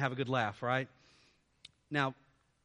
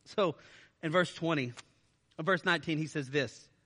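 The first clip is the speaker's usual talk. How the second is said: usual speaking voice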